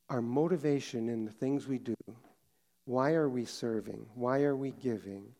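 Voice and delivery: soft, low prayer voice; closing prayer. Acted. Pastor leading the congregation in closing prayer, soft and low. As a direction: pray it quietly and humbly.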